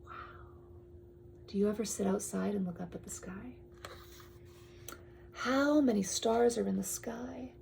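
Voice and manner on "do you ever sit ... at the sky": hushed question to little listeners; whispered question. Real, not acted.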